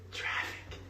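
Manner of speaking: hushed secret whisper. Shown as a demonstration. Speaker hushing the crowd on the key word, whispered.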